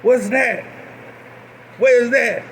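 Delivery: high, puzzled North Carolina backwoods voice; North Carolina accent. Retold or imitated. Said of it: "deep North Carolina accent".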